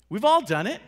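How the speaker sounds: ordinary talk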